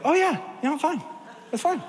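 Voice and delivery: high, breezy mock "I'm fine"; man imitates his date insisting she's fine, high and chirpy; forced cheerful denial. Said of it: "And she's like".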